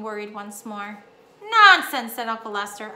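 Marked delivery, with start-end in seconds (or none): 1.4-2.1 loud, high burst of disagreement; emphatic dismissal. A narrator's reading. Uncle overruling a worried mother, emphatic. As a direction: dismiss it heartily and loudly.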